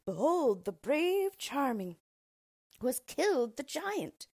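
loud, slow, high proclamation; crowd shouting. A narrator's reading. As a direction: call it out in triumph.

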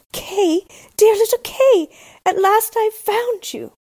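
high, loud joyful cry of reunion; calling out joyfully. Acted. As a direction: call out to him with relief and joy. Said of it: "and called out".

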